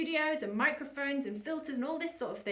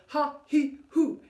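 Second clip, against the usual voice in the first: ha he hoo, louder, pushed from the navel; from the core; 6 dB louder than usual. Shown as a demonstration. Coach showing the right deep core breath. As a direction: punch it from the belly. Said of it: "Deep, navel back to spine".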